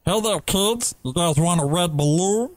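a high, hammed-up creepy clown luring kids.

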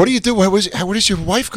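mock baffled rich man. Friend plays rich buyer questioning one bathroom, mock bafflement. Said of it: "He's like".

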